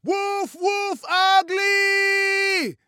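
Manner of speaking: long-drawn, high, full-voiced shout; drawn-out gleeful screech. A narrator's reading. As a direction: stretch every word into a slow, high yell. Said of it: "Godot and Steve screeched".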